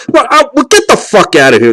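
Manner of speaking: loud, high, furious yell at the bald guy; mock flip-out. Matchmaker flips out, yelling at the bald client. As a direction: throw him out, screaming. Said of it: "she flips out".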